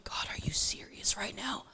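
incredulous whisper to God. Retold or imitated. Whispering to God after leaving the set, incredulous. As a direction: whisper it in disbelief.

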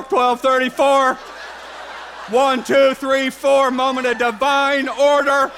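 loud, urgent sign-spotter in mock awe; excited prophetic believer. Preacher playing prophetic people reading a clock, hammed-up excitement. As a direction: proclaim each sign with hammed-up intensity. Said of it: "and prophetic people don't".

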